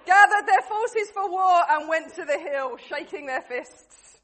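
loud, projected narrator opening a battle tale; story narration. A narrator's reading. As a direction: narrate grandly to a crowd, slowing at line ends. Narrates the play to the room.

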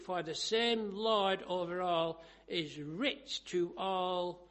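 loud, slow, measured proclamation, easing at the close; scripture reading. Acted. Preacher reading salvation verses to the congregation, slow declamation. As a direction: declaim each phrase with weight.

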